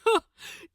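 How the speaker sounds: big booming fake-friendly laugh; a loud, hollow hearty laugh; loud false jolly laugh. Gabriel laughs before slapping.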